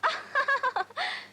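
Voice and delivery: high-pitched derisive laugh; mocking laugh. A woman mocking her ex-lover's respectability, mocking laugh. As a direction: laugh scornfully at his boast. Mocking his big-man claim.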